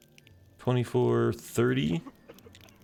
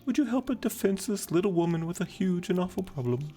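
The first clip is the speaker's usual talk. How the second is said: high-pitched damsel appealing for help; helpless little woman; 11 semitones higher than usual. Acted. Woman asks keeper for help, high hammed-up helplessness. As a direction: put on a high, pleading lady voice.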